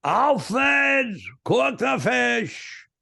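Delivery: very high, stretched-out shout; father's holler. Retold or imitated. Father yelling to his son in the family diner, high drawn-out holler. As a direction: yell it in dad's thick accent, every word dragged out. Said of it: "That was my father saying".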